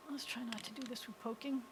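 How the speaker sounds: soft, near-whispered self-talk; muttered aside. Real, not acted. Teacher talking to self while working the screen, muttered. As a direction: mutter it under your breath.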